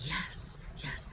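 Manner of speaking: hushed, awed yes-men; mock-adoring whisper; reverent hush. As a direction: whisper it like devoted followers. Entourage hanging on doctor's every word.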